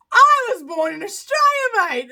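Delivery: high, loud, hammed-up Australian accent. Shown as a demonstration. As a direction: redo it in broad Aussie, through amusement. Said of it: "I said that in an Irish accent".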